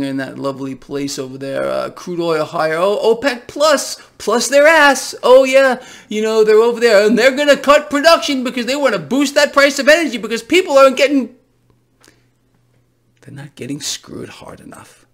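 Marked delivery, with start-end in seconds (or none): 2.8-11.3 angry sarcasm. Real, not acted. Mocking OPEC, angry rant. 13.2-15.1 whispered, bitter aside; sarcastic whisper. Acted.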